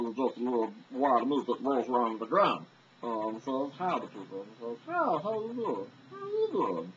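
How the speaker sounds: goofy cartoon wad of meat; Meatwad. Showing fans an Aqua Teen cartoon character, goofy impression. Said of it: "I don't know if you know Meatwad".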